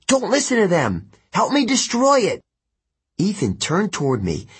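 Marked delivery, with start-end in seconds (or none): 0.1-2.4 high-pitched, urgent begging; pleading. Acted. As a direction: beg a friend to change sides. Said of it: "I pleaded".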